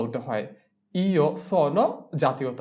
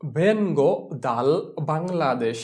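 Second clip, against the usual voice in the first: very slow, careful; slow model answer; much slower than usual. Shown as a demonstration. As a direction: drill it slowly. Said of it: "teacher models answer".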